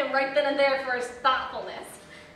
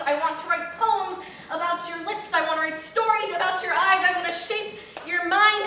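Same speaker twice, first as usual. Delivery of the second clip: lightly voiced ardent poet, louder and higher; mock grand declaration; 4 semitones higher, 7 dB louder than usual. Woman mimicking her date's writerly vows, mock-grandiose. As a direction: build the vows up, mocking his ardour.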